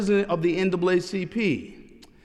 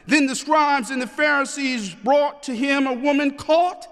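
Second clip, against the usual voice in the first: raised, ringing pulpit reading; scripture proclamation; 4 semitones higher than usual. Acted. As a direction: proclaim the verses to a congregation, phrase by phrase. Reads scripture aloud to congregation.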